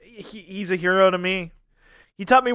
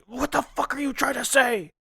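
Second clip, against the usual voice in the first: higher, softer mock-angry protest; mock outrage; 6 semitones higher, 5 dB quieter than usual. Cohost voices mad Fujoshi audience at his friend, mock outrage. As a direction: put on an indignant fan's outrage without shouting. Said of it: "are so mad at you".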